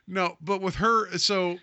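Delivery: ordinary talk, nothing marked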